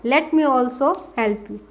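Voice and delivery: drawn out, each word separate; slow dictation. Shown as a demonstration. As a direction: dictate slowly for the copy. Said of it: "क्या बोला जा रहा है?".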